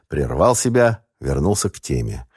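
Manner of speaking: ordinary delivery, nothing marked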